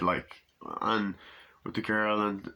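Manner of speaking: usual speaking voice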